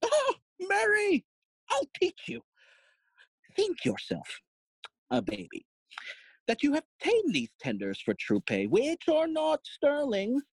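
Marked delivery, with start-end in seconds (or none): 0.0-6.7 patronising father; mock instruction. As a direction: lecture the child in a high mocking voice. Father mockingly lectures daughter.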